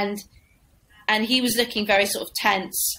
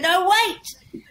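much higher, slow, laughing plea; frantic MC; 9 semitones higher, 3 dB louder, slower than usual. Retold or imitated. MC begs the crowd to stay for one more act, high. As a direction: squeal it like a panicking host. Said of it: "he went".

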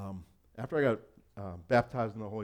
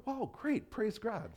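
much higher, brightly oblivious; naive cheer; 11 semitones higher, slower than usual. Retold or imitated. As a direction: chirp it out, clueless and delighted. Naive cheerful reply, then "bad move.

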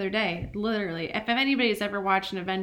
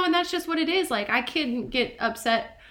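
usual speaking voice

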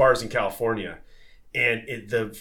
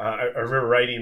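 normal talking voice

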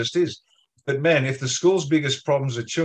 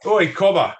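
raised, higher-pitched call to a mate; a sharp "oi" to pull someone up; 6 semitones higher, 5 dB louder than usual. Acted. Pub drinker to a mate getting out of control, calling out.

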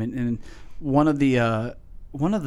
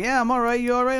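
high, bright-pitched sing-song greeting; cheery all right greeting; 11 semitones higher, faster than usual. Retold or imitated. Teasing Dave by repeating his greeting, high and sing-song. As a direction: pitch it way up like a chirpy hello. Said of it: "He's like".

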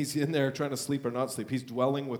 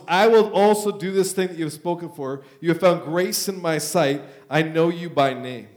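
scripture reading; 3 semitones higher, 7 dB louder than usual. Acted. Preacher reading Moses' plea to the church, loud and proclaiming. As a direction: proclaim the verse to the congregation.

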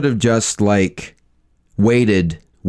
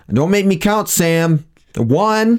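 stern parent counting; 9 semitones higher, slower than usual. Pretend.

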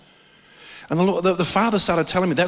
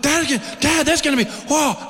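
put-on higher, thrilled kid voice; excited little son; 9 semitones higher than usual. Father imitating his thrilled son on the drive. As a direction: sound like a boy spotting a campsite.

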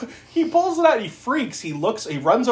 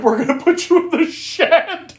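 mock reassurance; 14 semitones higher, 4 dB louder than usual. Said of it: "they're like".

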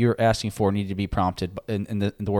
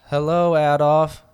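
slow, louder, drawn-out chorus reply; mock group greeting; 6 semitones higher, 6 dB louder, slower than usual. The support group greets Adolf, slow mock chorus. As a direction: answer like a whole circle of chairs.

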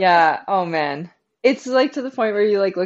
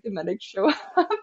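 ordinary delivery

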